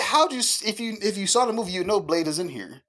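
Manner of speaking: high-pitched exasperated retort, stumbling at first. Retold or imitated. Brother to sister who saw the movie, exasperated. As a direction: imitate baffled irritation, starting high and easing down. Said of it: "they know I get mad at shit like that".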